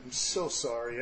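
low, very soft, halting remorse; retold tearful apology. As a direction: murmur it brokenly, lightly acting tearful. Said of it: "And I was crying, and I was weeping".

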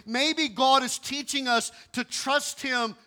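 high, loud and slow, weighing each phrase; slow, high, ringing emphasis; slow emphatic preaching. Real, not acted. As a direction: land the main point slowly. Sermon climax, slow emphasis.

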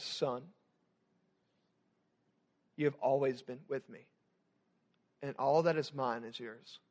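soft, quiet and tender; gentle father. Acted. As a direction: hush down to answer him with grace. Father answers resentful son gently.

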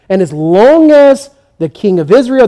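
normal talking voice

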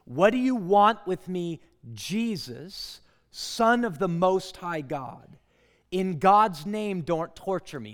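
loud anguished cry of the possessed man; loud desperate plea; demon's shout. Acted. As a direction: cry out loud at the top of your voice. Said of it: "shouted at the top of his voice".

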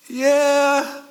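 high, drawn-out reluctant yeah; mock noncommittal. Imitated believer the next day, high and noncommittal. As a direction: stretch it out high and half-hearted.

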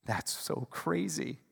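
normal talking voice

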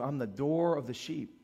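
ordinary talk

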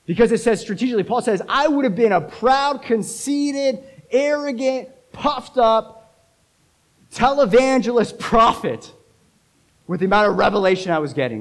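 high, loud pulpit delivery; forceful preaching. Real, not acted. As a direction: drive each point home to the congregation.

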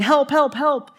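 loud high repeated cries of help; yelling for help. Retold or imitated. Lifeguard imitates swimmers yelling for help. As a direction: shout help, help, help like you're drowning. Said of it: "They're yelling".

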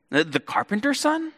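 high, doubting question; incredulous crowd. Retold or imitated. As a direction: hold on to the doubt a moment longer. Crowd questions Jesus' origins.

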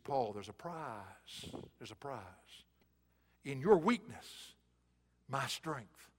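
God inviting Paul, hushed and slow; God's tender voice. Acted. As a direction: speak as God close to Paul.

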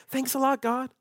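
mock-bitter sarcasm to God; sarcastic complaint. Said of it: "we say similar things today".

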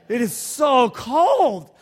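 loud, high, slow complaint about the cold; cold shout. Real, not acted. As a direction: shout how freezing it is. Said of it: "That's not what Panama feels like".

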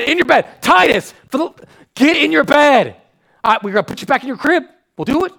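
high, loud frantic bed orders; fed-up dad hollering at bedtime; exasperated yelling. Retold or imitated. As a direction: shout the kids back into bed over and over.

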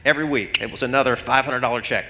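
usual speaking voice

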